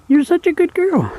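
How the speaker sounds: very high cooing praise; high pet praise. Real, not acted. Owner praising her patient dog, high coo.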